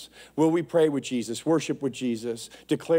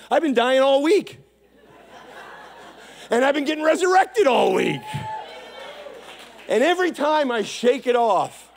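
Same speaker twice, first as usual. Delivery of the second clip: preacher shouting his own week of dying and rising; preaching at full force; 10 semitones higher than usual. Real, not acted. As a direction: shout it out like testimony at the pulpit.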